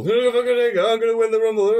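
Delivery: British Bulldog impression. Friend mocking Bulldog's unintelligible promo, high garbled imitation. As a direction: mumble it like a wrestler who can't talk. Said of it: "unintelligible garble".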